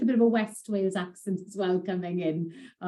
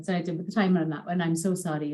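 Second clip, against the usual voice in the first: ordinary talk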